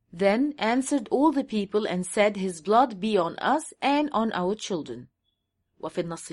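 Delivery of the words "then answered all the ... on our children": very slow, word-by-word English Bible reading; slow scripture reading. Shown as a demonstration. Reading the English verse aloud to his audience, very slow and deliberate. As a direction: lay out each word of the verse slowly.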